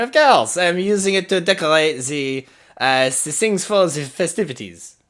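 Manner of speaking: high, loud character voice; excited Eddie. Acted. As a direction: burst out with eager, over-the-top enthusiasm. Eddie answers Hera in character.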